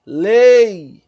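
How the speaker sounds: "Lei" very loud, the E held long; loud drawn-out demo of "Lei"; sustained E. Shown as a demonstration. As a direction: stretch the E of "Lei" before a short I. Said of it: "qual é a vogal que se sustenta mais".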